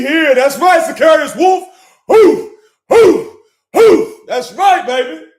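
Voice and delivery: high, slow, loud cheering; hyped shout. Real, not acted. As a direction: whoop it up for the viewer. Hyping a viewer on stream.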